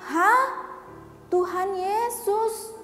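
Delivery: high, slow startled gasp of a question. Acted. Blind beggar hearing Jesus is near, stunned and high. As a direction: caught off guard and hopeful.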